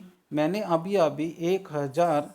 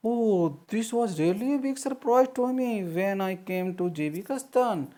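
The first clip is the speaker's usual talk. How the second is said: slow, higher-pitched reading with drawn-out words; lesson read-aloud; 6 semitones higher, much slower than usual. Real, not acted. Teacher reading a correspondent's surprise to students, slow raised read-aloud. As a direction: read the quote out slowly, pitched up.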